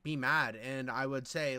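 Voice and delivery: usual speaking voice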